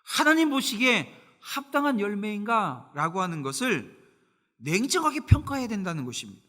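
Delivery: loud, rising sermon delivery with emphatic pauses; fervent preaching. Acted. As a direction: preach it out to the congregation. Preacher at full force. (힘 있는 설교조)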